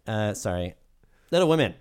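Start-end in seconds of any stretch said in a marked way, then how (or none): 1.3-1.7 Little said loud and high; loud emphasis. Acted. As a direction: punch the title loudly. Restarting review with emphasis.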